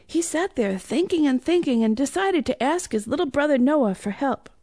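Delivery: ordinary delivery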